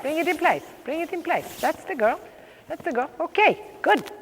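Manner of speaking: high encouraging praise; excited praise. Real, not acted. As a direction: lift the voice to praise. Praising horse as it succeeds.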